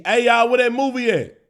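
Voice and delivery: calling loudly through the house for the movie; mock calling out. The aunt shouting to the kids for the movie, a loud call. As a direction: call out like an aunt looking for her DVD.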